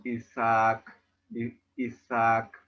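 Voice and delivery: ISAAC slowly, with a stretched level "aa"; long vowel. Shown as a demonstration. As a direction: hold the double "a" of "Isaac". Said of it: "Si es larga como". ("Isaac" lento, con la vocal larga)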